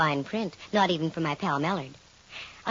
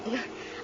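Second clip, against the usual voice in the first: a quick, startled little laugh; short laugh; 3 semitones higher, 10 dB quieter than usual. Real, not acted. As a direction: laugh off the scare. Startled by sudden bank.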